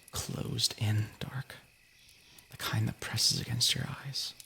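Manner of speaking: slow whispered storytelling; story narration. Acted. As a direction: draw them into the dark story. Reads a creepy story.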